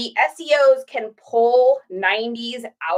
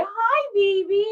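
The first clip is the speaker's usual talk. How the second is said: high, slow cooing to the pup; drawn-out coo at the dog; 10 semitones higher, slower than usual. Real, not acted. Greeting her dog, slow high baby talk.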